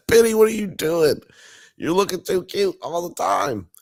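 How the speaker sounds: high-pitched, cutesy pet-talk voice; sing-song fussing over a cutie; doting coo. Real, not acted. Cooing aside to Penny.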